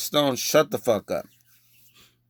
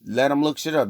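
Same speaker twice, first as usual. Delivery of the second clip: ordinary talk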